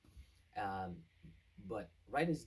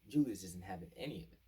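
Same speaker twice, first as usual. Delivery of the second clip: ordinary delivery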